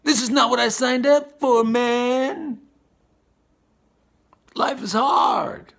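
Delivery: hammed-up despair of someone overwhelmed; exasperated outcry. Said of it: "and you're like".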